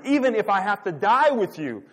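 imitating a loud, high-pitched vow; emphatic insistence. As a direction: insist on loyalty, pushing the voice up and out. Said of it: "But Peter insisted emphatically".

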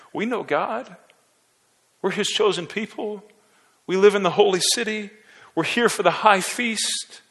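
high, self-satisfied boasting; smug crowd. Retold or imitated. The crowd boasts of their holiness, high and smug.

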